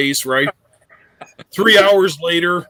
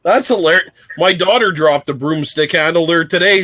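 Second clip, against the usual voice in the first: ordinary talk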